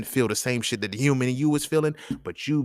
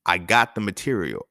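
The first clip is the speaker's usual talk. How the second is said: normal talking voice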